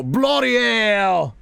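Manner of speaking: hammed-up Aussie shout, high and drawn out; playing a panicked Australian spotting a kangaroo; mock Australian accent. Streamer mocking an Aussie reaction, hammed-up Australian accent. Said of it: "say with an accent".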